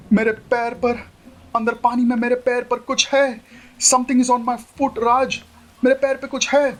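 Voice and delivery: high, panicky bride; frightened young woman; scared. Acted. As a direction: squeal it in rising fright. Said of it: "डरो मत".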